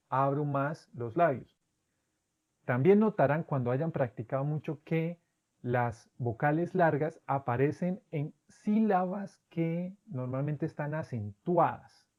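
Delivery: usual speaking voice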